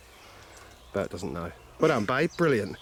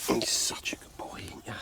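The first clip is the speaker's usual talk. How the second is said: whisper to the dog; 5 dB quieter than usual. Real, not acted. Whispered praise to his dog.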